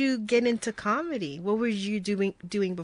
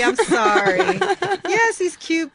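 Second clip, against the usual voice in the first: giggling through the words; laughing while talking; 8 semitones higher, 8 dB louder than usual. Real, not acted. Laughing apology about colicky baby.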